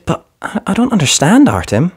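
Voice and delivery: put-on a little girl who doesn't get it; confused little girl. A narrator's reading. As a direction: falter like a shy six-year-old.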